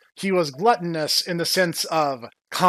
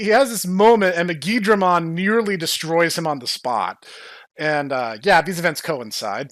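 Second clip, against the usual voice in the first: ordinary talk, nothing marked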